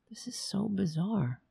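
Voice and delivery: baffled whisper to himself. Real, not acted. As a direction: breathe it out, puzzled. Baffled by broken software.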